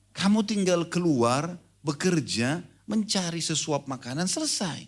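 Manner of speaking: slow, high, drawn-out counsel; slow mock advice. Preacher voices the sheikh's slow, high advice to the man. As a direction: lay it out slowly like a wise sheikh.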